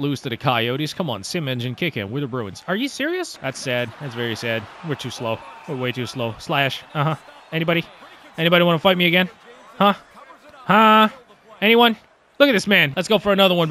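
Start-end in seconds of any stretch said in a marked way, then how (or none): none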